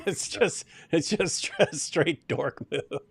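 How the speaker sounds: slow words through laughter; laughing. Real, not acted. Host mocking the blue background while laughing.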